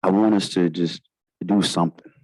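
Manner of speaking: normal talking voice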